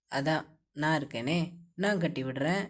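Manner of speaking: low male voice, soft and playful; husband teasing his wife, low and soft. A narrator's reading. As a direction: tease your wife lightly, low and soft.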